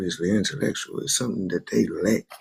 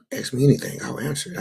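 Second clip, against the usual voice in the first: usual speaking voice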